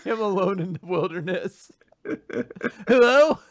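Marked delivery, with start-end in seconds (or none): 2.9-3.4 high, loud call into emptiness; mock forlorn holler. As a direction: call out for missing friends. Calling out alone in the wilderness.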